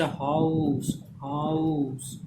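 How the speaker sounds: हाउस slowly stretched from आ into उ; diphthong demonstration. Shown as a demonstration. Teacher showing two vowel sounds in हाउस, slowly drawn out. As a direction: draw out the glide inside हाउस. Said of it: "दो vowel sound आ रहा है".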